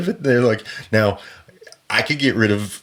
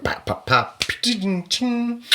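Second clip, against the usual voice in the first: imitated notification sounds; 14 semitones higher, 5 dB quieter, much slower than usual.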